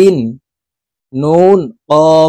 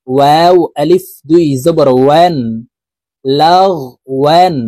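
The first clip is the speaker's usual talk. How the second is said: ordinary talk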